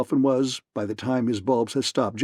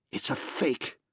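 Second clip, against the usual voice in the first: dropped, hoarse and much softer; a hoarse, softer gasp of disbelief; 7 dB quieter than usual. A narrator's reading. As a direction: rasp it out, stunned. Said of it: "he said hoarsely".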